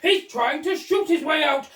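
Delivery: loud, high alarm; alarmed shout. Acted. Duke alarmed after gunshot.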